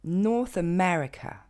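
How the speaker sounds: North America à l'anglaise, lentement, ME bien marqué; accent sur ME. Shown as a demonstration. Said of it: "le vrai accent tonique va tomber sur le mais". (North America, accent secondaire sur North)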